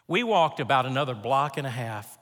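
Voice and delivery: usual speaking voice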